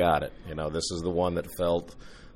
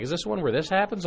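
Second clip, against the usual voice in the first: put-on high, curious little girl voice; childlike eager question; daughter asking; 11 semitones higher than usual.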